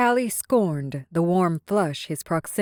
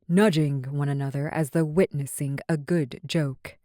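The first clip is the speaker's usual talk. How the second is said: ordinary delivery, nothing marked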